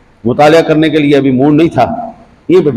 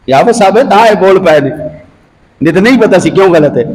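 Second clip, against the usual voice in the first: ordinary delivery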